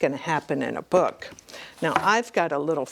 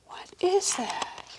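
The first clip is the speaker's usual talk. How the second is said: quicker high whisper; amazed whisper; 14 semitones higher, 4 dB quieter than usual. A narrator's reading. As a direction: ask it again softly. Reader amazed at picture.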